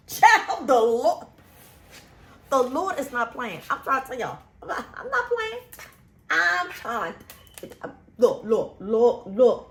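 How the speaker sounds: fervent preaching. Real, not acted. Vlogger warning scammers watching, loud fervent preaching. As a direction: hammer the point home to the listeners.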